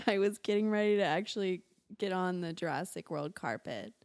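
normal talking voice

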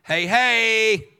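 mock call. God addressing the wicked dead.

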